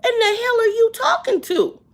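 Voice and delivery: high, loud, outraged yelling at him; furious rant. Retold or imitated. Berating the rude cashier, high loud outrage. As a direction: tear into him with indignant fury. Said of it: "I'm like".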